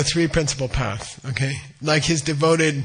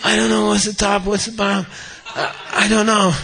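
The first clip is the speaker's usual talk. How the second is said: higher-pitched, stammering mock panic; mock freaking out; 5 semitones higher, faster than usual. Stumped logician answers the cowherd, acted freaking out. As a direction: play a man having a comic crisis. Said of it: "He's, like, freaking out".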